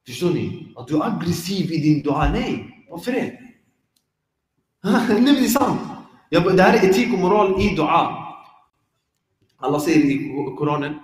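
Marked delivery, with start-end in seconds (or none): none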